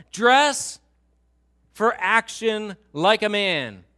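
very loud, high, slow order; commanding God. Acted. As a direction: boom it out with a break after the first word. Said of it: "God is speaking out of the whirlwind".

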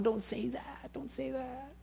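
high, hushed, embarrassed plea; modest protest. Acted. Pastor waving off a member's praise, softly pleading. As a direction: shrink from the praise in a small high voice.

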